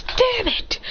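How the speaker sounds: high-pitched cry of pain and annoyance; shocked yelp. Acted. Character zapped in the arm by a trap, shocked yelp. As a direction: yelp it, voice jumping way up.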